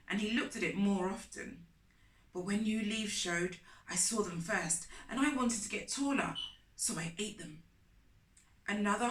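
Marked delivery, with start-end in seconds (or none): none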